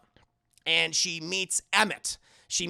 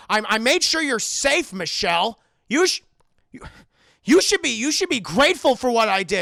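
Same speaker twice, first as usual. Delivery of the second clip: higher, louder, indignant outburst; angry demand; 4 semitones higher, 7 dB louder than usual. Retold or imitated. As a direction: flare up at her for being ungrateful. Howard snaps at captive.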